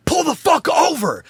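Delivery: high, loud yell. Retold or imitated. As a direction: yell it at the driver. Retelling yelling at his friend.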